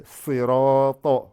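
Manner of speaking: sirata with a heavy sad and ta, slow and loud; swollen sirata. Shown as a demonstration. Teacher making students hear the swollen letters, emphatic. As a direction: round out sirata full and heavy. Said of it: "Vous entendez".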